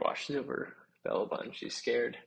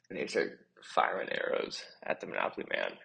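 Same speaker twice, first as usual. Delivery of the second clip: ordinary delivery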